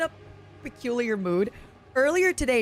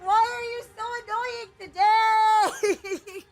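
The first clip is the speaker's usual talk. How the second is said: exasperated yell; 10 semitones higher, slower than usual. Real, not acted. Gamer shrieking at an annoying enemy, high exasperated yell. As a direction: shriek at the pest.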